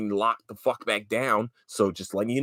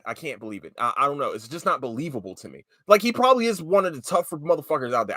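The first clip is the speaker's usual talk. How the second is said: ordinary talk, nothing marked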